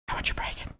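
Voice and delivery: breathy whisper. Real, not acted. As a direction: whisper it with longing. Whispered urge to break it.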